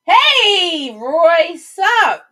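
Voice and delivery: high, loud quick hello; greeting a viewer popping into the stream, high and loud; excited greeting. Real, not acted. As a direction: call out to a buddy.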